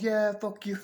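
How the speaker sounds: high-pitched mimicked comeback; mocking high voice, about to laugh; mock parting shot. Retelling her parting shot, then laughs.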